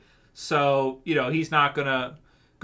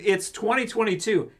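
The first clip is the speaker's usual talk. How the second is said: ordinary talk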